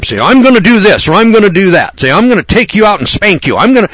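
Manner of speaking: high, loud mock threats; threatening mother. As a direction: imitate a mom piling up threats. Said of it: "and then she threatens him".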